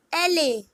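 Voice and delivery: L A run together as "EH-leh"; Spanish letter L. Shown as a demonstration. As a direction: say L A as one Spanish word, ele. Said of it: "L is pronounced as".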